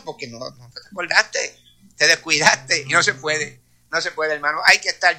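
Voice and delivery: ordinary delivery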